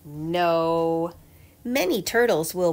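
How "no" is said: usual speaking voice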